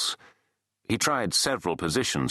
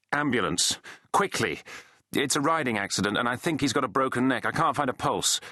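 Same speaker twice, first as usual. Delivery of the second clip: higher, pressed plea for an ambulance; urgent emergency call; 7 semitones higher than usual. Acted. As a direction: report the accident with mounting urgency. Urgent emergency call for injured rider.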